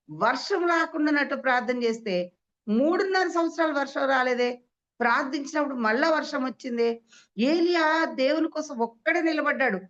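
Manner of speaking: high, ringing sermon delivery; forceful preaching. Real, not acted. Preacher proclaiming Elijah's prayer to congregation, forceful preaching. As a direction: proclaim each phrase high and emphatically.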